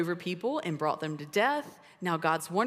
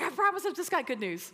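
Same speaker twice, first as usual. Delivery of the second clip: higher, eager reassurance; eager angel promising; 9 semitones higher than usual. Retold or imitated. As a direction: promise them it's only good news. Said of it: "he's like".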